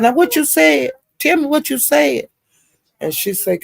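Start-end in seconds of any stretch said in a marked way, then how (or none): 0.0-2.3 higher, pushy demand voice; demanding Pizarro. Retold or imitated. Pizarro confronting the woman, high demanding voice. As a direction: press her for what she said.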